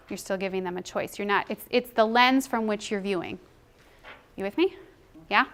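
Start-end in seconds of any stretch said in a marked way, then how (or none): none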